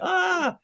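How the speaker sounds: very high-pitched sustained "ah"; mock high "ah". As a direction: imitate a reaction with a high squeal. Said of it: "and we'd be like".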